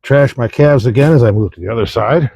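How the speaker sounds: ordinary talk, nothing marked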